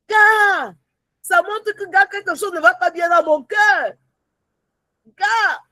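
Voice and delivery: high, emphatic exclamations; alarmed warning. Acted. Believer noticing her heart is wrong, alarmed. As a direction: call out the warning, pitched well up. Acted alarm at fading zeal.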